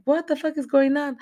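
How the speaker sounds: imitated high, loud disbelief; shocked outcry. Brittany's character hearing about grandma, acted shocked outcry.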